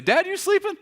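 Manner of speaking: a small son poking his sleeping dad; kid calling dad. Retold or imitated.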